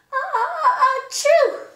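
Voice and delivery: high loud gasps into an achoo; big sneeze. Acted. Gerald sneezing at Piggy, gasping achoo. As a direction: gasp high then sneeze hard.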